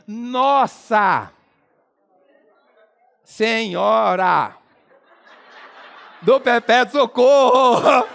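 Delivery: very slow, drawn-out exclamation with long pauses; grand full expression. Shown as a demonstration. Comedian expanding "nó" in full, slow mock-grand build. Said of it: "abreviação de uma grande velha expressão".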